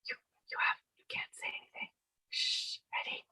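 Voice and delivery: unvoiced hushed whisper; ASMR whisper. Shown as a demonstration. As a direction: whisper with no voice at all. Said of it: "A S M R".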